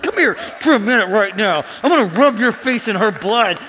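loud, hammed-up menace; retold angry threat. Grandson threatening people who mocked his grandma, acted-out anger. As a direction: act out a furious grandson going after bullies.

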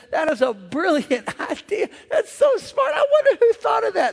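put-on high, amazed old farmer voice with a laugh. The farmer watching the flying frog, high amazed voice. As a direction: marvel up high like a country farmer. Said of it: "he said".

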